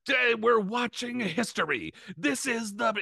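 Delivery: high, dramatic commentator; grand announcer. Retold or imitated. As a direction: make it sound historic. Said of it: "Lampley's like".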